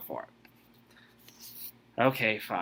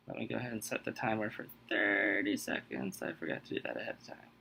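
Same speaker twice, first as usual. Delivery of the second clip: much softer, half-whispered aside to self; muttered aside; 5 semitones lower, 12 dB quieter, faster than usual. Real, not acted. Host mutters to himself while setting the timer, quiet aside. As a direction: mumble it to yourself while fiddling.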